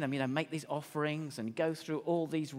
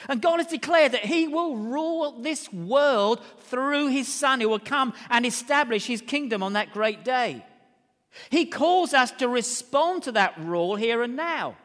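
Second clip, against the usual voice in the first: raised, emphatic pulpit voice; preaching; 8 semitones higher, 9 dB louder than usual. Real, not acted. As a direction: preach it loud and high, driving home each phrase. Preaches at full force.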